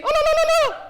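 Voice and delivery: very high trilled wailing cry; hammed-up motherly lament, tongue rippling; mock wail. Mother's wail after reciting full name.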